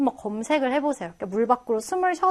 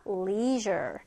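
slow demonstration; 6 semitones lower, 4 dB quieter than usual. Shown as a demonstration. Teacher modelling a hard word for learners, slow demonstration. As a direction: say "leisure" slowly, low and soft. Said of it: "천천히 해보겠습니다".

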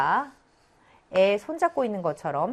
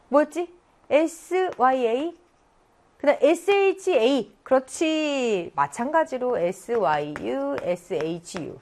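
ordinary talk, nothing marked

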